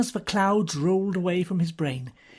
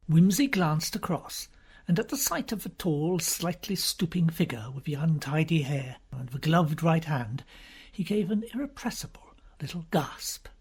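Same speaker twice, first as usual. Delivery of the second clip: usual speaking voice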